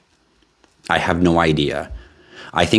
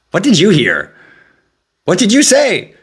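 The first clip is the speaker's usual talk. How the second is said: much higher, louder, hammed-up disbelief; mock shocked; 13 semitones higher, 5 dB louder than usual. Streamer teasing viewers about his slip, high mock shock. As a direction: squeal it out in fake outrage.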